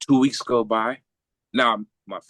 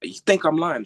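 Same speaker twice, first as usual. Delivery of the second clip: angry doctor; 10 semitones higher than usual. Retold or imitated. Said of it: "She was pissed".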